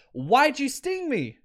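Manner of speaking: high, drawn-out mock plea; lightly acted, stretched-out question of betrayal; dying frog's protest. Dying frog asks the scorpion why, high mock protest. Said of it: "the frog looks at the Scorpion and goes".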